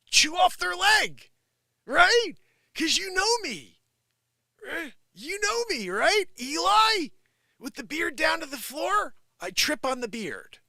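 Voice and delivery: high, loud pleading with a horse; mock-urgent orders to an animal. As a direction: coach the horse in a put-on high voice.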